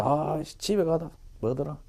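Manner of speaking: softly thinking aloud, searching for the word; puzzled muttering. Retold or imitated. Student trying to recall "go home" in English, puzzled muttering. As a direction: act a learner groping for a word, half under the breath. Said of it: "이런 식으로 생각해요".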